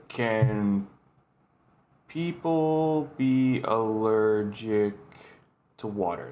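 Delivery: slow, word by word while typing; distracted, halting search-query voice; typing aloud. Real, not acted. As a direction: draw out each word as you type it. Typing a search on his phone.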